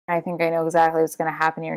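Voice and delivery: usual speaking voice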